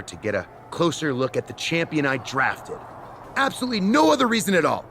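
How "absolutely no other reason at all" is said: fast, higher and louder mock-innocent sign-off; sarcastic taunt. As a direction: rattle it off with fake innocence, then a cheeky call. Miz feigns innocence, leaves taunting.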